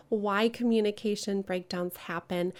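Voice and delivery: usual speaking voice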